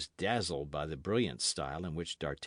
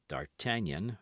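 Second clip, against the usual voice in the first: plain reading voice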